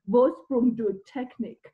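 mock a Brit butchering "Vorsprung durch Technik" in an English accent; imitating an English bloke's bad German in an English accent; terrible English accent. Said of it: "in a terrible English accent".

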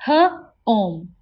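H-ome split into onset and long vowel; segmented. Shown as a demonstration. Teacher sounding out H-ome for the class. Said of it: "Can you say it with me?".

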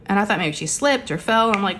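ordinary talk

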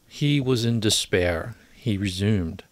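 usual speaking voice

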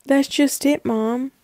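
very soft, downcast setup; feigned dejection. Acted. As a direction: hold back the good news, quietly. Sets up surprise for mom.

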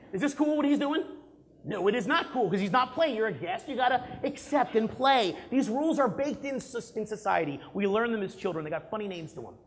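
ordinary talk

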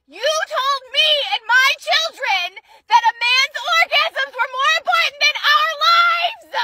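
high, slow, raw shouted rage at her mother; furious screaming. Real, not acted. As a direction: scream it out, voice cracking toward tears.

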